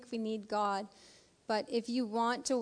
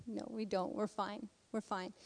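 retold denial, much softer; acted dismissal; 9 dB quieter than usual. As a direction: wave away the offer quietly. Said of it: "and they're like".

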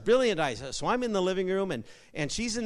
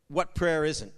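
ordinary talk, nothing marked

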